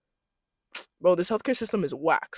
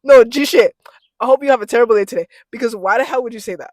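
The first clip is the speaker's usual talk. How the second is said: loud, emphatic telling-off; indignant shout; 4 semitones higher, 13 dB louder, faster than usual. Real, not acted. Scolding everyone who said she was pregnant, indignant shout. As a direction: burst out louder and higher, rushing the words.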